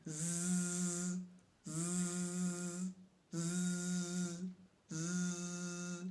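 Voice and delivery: soft Z buzzed through a clenched smile; buzzing Z, teeth clenched. Shown as a demonstration. Singing teacher demonstrates the Z with clenched teeth, soft buzz. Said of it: "mâchoire serrée ... en souriant".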